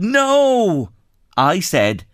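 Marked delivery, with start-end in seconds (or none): 0.0-0.9 mock yell. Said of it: "She yelled".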